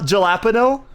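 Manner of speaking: "jalapeno" as juh-LAP-uh-no, questioning rise; spelling pronunciation. Shown as a demonstration. Streamer checking how chatter says it, spelling pronunciation. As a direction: ask "jalapeno" with hard J, stress LAP. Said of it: "So you do say jala".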